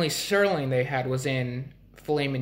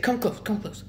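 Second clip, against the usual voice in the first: high-pitched retold beckoning; imitated burger worker; 8 semitones higher than usual. Burger worker beckoning customer to hear a secret, high imitated voice. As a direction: draw the listener in as the burger guy. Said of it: "He said".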